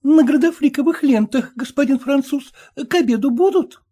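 high pleading wife's voice; timid merchant's wife pleading, voiced high. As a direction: beg the husband to let her listen, pitched high. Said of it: "задала вопрос купчиха".

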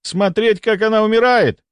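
high-pitched desperate retort; exasperated howl. A narrator's reading. A doctor refuses to watch the patient die, howling in exasperation. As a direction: wail it back in protest. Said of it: "взвыл он".

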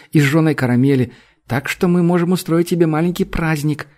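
put-on high, eager little girl. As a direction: coax her like a small girl. Narrator voices little girl Uk.

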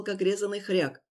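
usual speaking voice

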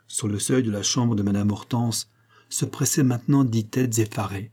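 ordinary delivery

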